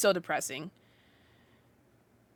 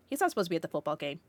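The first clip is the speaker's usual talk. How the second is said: usual speaking voice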